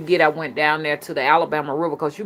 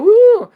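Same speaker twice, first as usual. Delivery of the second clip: high, loud imitated whoop; exaggerated high "woo"; mock squeamish "woo"; 12 dB louder than usual. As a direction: mimic their shocked hoot. Mimics squeamish listeners.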